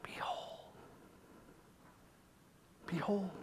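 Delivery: soft, hushed call; hushed and unhurried; gentle whisper. Acted. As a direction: whisper it like a quiet revelation. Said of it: "because it gently whispers".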